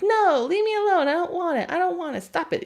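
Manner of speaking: high, loud, whining refusal; a dog begging to be let alone; whiny dog voice. Retold or imitated. Dog to the person who keeps petting, high whiny refusal. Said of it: "He's like".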